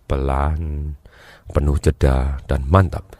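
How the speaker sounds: slow, low delivery full of pauses; unhurried, low, firmly settled; slow and steady. Shown as a demonstration. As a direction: drop the pitch and draw each word out. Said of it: "Kalau dianya ngomongnya lambat, pelan, penuh jeda".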